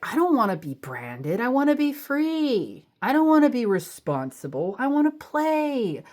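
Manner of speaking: put-on whiny inner child. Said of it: "It feels like you're like".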